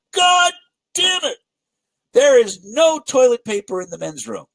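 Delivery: raging bodybuilder yelling at the front desk; angry yell. Retold or imitated. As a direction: pound the desk and bellow it out. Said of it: "He pounds on the desk".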